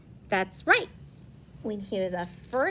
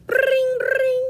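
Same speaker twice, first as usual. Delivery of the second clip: phone ring; 14 semitones higher, 7 dB louder than usual. Shown as a demonstration. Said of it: "imitating phone ring".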